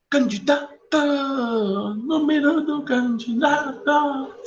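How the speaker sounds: high, slow campaign announcer; mock candidate pitch; politician voice.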